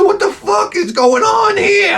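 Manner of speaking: very high, drawn-out mock scream; hammed-up freak-out, voice shooting way up; panicked shout. Spooked owner alone in dark house, panicked yell.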